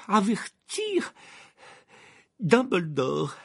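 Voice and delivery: high, halting, drawn-out plea; agitated man labouring over his words; struggling to speak. A narrator's reading. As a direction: force each word out slowly, pitched high.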